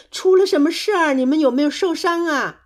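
high, loud anxious questions; worried call. A narrator's reading. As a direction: call out with concern. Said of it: "担心地呼喊着".

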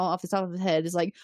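ordinary delivery

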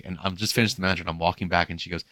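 ordinary talk, nothing marked

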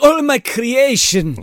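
high, slow, drawn-out mock gloating; mad scientist. Doctor adoring his animatronic creation, hammed-up mad scientist.

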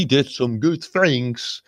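a hint of a German accent, drawn out; mock German voice. Friends joking about Hitler, German accent. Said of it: "do your German voice".

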